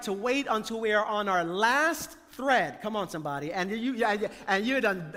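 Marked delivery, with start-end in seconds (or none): none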